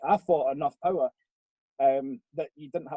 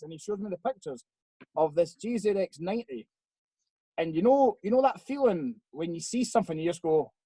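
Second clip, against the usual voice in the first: ordinary delivery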